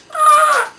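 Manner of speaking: a high, loud cry of joy; joyful shout. Retold or imitated. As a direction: burst out with delight. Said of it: "Tout content". (cri de joie aigu et fort)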